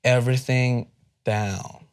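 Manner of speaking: drawn-out, deliberate words; slow American-accent practice pace; slowed right down. Shown as a demonstration. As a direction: stretch each word with gaps between. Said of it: "you need to literally slow everything down".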